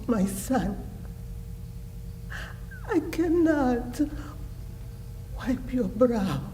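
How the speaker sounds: high, tearful anguished plea; anguished grief. Acted. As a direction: plead in grief, voice lifting towards tears. Mother grieving over her dying son.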